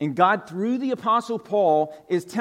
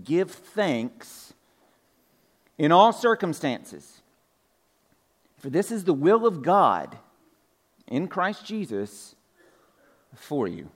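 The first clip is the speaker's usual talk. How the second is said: slow, weighty reading of the verse; scripture reading; slower than usual. Acted. Pastor reading the verse to the church, slow and measured. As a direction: read it out phrase by phrase with pauses.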